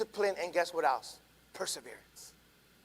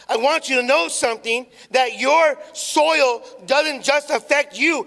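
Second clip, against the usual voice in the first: slow, louder, weighted words; louder preaching; 4 semitones higher, 7 dB louder, slower than usual. Acted. Pastor repeating the warning to his church, louder. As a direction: press each word on them.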